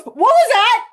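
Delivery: high, loud retold shriek of alarm; startled mock outcry. Client hears a meow from nowhere, startled outcry.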